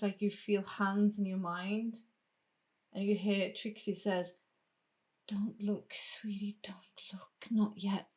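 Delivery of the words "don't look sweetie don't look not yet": gentle urging that fades into a whisper; hushed, whispery "keep away" plea; hushed warning. Acted. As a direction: drop to a whisper midway. Trixie hushing player inside a vision.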